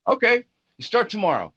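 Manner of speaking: high mock voice of Ronnie; Ronnie quoted. Ronnie voiced in retold story.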